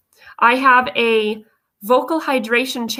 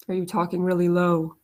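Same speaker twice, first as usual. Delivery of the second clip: much lower, softer, pressed-down voice; demo of talking low; 5 semitones lower, 6 dB quieter than usual. Shown as a demonstration. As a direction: drop the voice low and quiet to show the habit.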